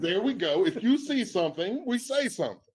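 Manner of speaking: coach's voice. Shown as a demonstration. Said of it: "So in my coach's voice".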